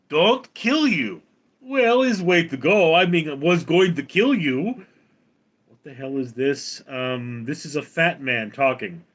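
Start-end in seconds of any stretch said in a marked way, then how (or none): 0.1-4.9 higher, louder mock Russian accent; puzzled dictator in a Russian accent; Putin. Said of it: "And Putin goes".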